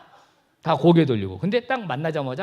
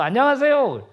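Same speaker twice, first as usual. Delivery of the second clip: very high, loud, smiling hello; beaming greeting; 10 semitones higher, 7 dB louder than usual. Shown as a demonstration. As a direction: greet them with a big audible smile. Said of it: "활짝 웃으면서".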